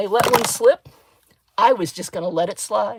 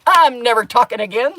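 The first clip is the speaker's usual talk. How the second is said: sarcastic quip; 4 semitones higher, 8 dB louder than usual. Retold or imitated. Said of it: "I went".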